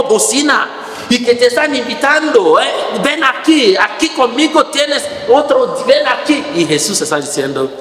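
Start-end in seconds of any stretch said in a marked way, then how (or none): 3.0-6.4 high, loud enticing call; world's loud call. Retold or imitated. The world's voice shouting to lure the faithful, high and loud. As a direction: call out like a barker luring people. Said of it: "la voz del mundo grita tan fuerte".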